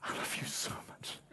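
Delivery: soft, breathy whisper to his son; tender whisper. Retold or imitated. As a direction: whisper it close to the child's ear. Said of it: "and says".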